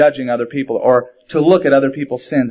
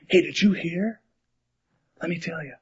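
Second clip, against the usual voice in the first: soft, confidential tattling; hushed gossip; 2 semitones higher, 10 dB quieter than usual. Acted. A gossip telling tales behind someone's back, hushed. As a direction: drop right down as if sharing a secret.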